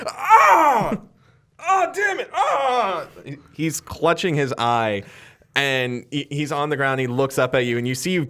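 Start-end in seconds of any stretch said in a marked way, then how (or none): 0.1-3.1 high, pained shouts; a villain hit in the eye; cry of pain. Acted. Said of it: "He goes".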